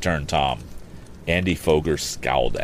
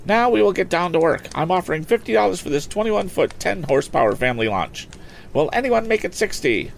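high, brisk auctioneer selling a boat; auctioneer's pitch; 14 semitones higher than usual. Acted. Auctioneer coaxing bids from the lakeside crowd, lively and high.

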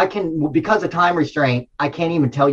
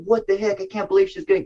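retold frustration; 6 semitones higher, 3 dB quieter, faster than usual. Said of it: "at that time I was frustrated".

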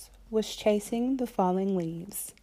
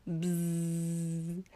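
buzzing. A narrator's reading. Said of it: "said Little Fox".

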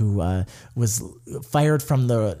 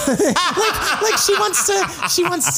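high, giggling words; breaking into laughter mid-sentence; laughing; slower than usual. Real, not acted. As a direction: laugh it out while speaking. Laughing at the new term.

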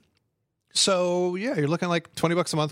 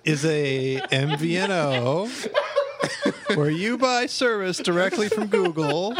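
laughing; 5 semitones higher, slower than usual. Real, not acted. Laughing through a tech explanation.

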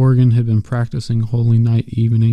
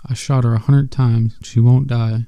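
usual speaking voice

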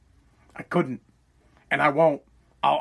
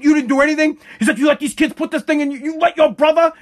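loud, high mock yelling father; father scolding his son, imitating the yell; angry dad; 9 semitones higher, 4 dB louder than usual. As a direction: shout down at the son.

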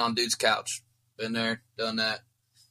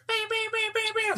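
high, squeaky alarm beeping; fire alarm beeps. Retold or imitated. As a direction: beep like a smoke alarm. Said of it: "The fire alarm's going off right now".

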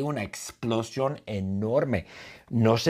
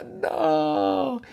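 long, high-pitched mock scream; acted scream. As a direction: cry out in high, drawn-out horror, hammed up. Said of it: "ella grita". (grito fingido, agudo y alargado)

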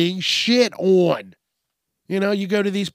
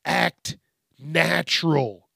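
usual speaking voice

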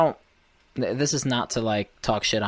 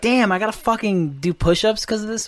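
higher pitched, fed-up griping; annoyed rant; 10 semitones higher than usual. Retold or imitated. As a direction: gripe like a recruit stuck doing push-ups. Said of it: "I'd have been like".

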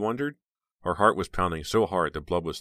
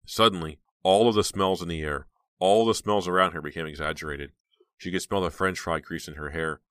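ordinary delivery

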